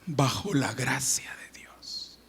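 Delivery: 1 whisper. Acted. Line end dropped to a whisper.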